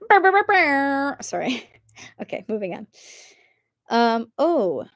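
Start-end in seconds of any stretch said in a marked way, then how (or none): 0.1-1.2 loud, high vocal air-horn blasts; slow buzzing "brr" like an air horn; imitated air horn. Streamer cues celebratory air horns, imitating them. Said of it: "Cue air horns".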